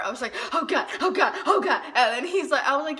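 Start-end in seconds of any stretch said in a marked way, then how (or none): 0.5-1.8 higher, louder, giggling; excited squeal. Retold or imitated. Girl reacting to her crush inviting her over, thrilled. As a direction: gush in disbelief.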